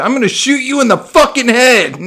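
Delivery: high, loud mock cop threat; cop yelling threat. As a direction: bellow it like an angry cop.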